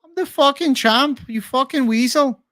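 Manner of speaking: very high, hammed-up Irish accent; botched Irish accent. Streamer mimicking Ian Garry defending his wife, high botched Irish accent. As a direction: do a bad Irish accent that slips toward a film caricature. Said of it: "I think that sounds more like Fat Bastard".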